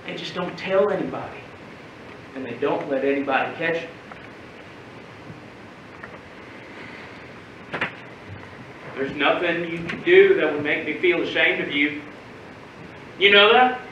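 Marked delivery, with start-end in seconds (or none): 0.1-12.0 low, hushed reassurance; tender confiding. Acted. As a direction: share it quietly with a boy who cried. Uncle reassures ashamed boy privately.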